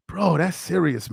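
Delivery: usual speaking voice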